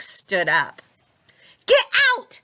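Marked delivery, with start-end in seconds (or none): 1.7-2.4 high, loud urgent yell. A narrator's reading. Annabeth yells at everyone to flee, high and loud. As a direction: shout them off the bus. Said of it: "Annabeth yelled".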